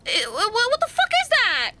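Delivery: high, shrill disbelief; freaked out, voice jumping up; shocked outburst. Real, not acted. Reacting to creepy sight.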